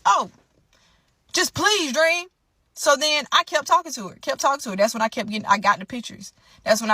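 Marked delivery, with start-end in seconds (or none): 0.0-2.3 mock whiny plea. Boyfriend pleading once more.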